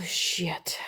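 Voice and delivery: breathy hushed shit; whispered curse. Pretend. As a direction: whisper the swear under your breath. Whispered curse after moon voice.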